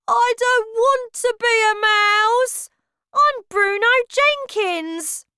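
high, loud, slow wail of protest; distressed protest. Acted. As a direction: protest in panic. Bruno horrified at being mouse.

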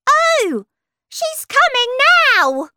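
very high, loud alarm call; excited, squealed announcement; crying out. Acted. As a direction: cry out the news to everyone. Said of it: "cried another flower".

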